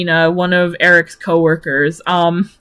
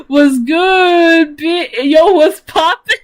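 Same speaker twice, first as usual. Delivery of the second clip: higher, louder, a shouted yo, what's poppin; over-the-top street greeting called out loud; hammed-up bro greeting; 8 semitones higher, 3 dB louder, slower than usual. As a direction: bellow it like a hype bro. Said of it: "hammed-up bro greeting".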